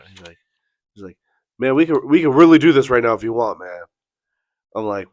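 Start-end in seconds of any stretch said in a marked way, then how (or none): 1.6-3.8 loud, fast mock tough talk; retold challenge. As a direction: rattle off a rival's challenge, louder and higher. Rival challenging him to fight.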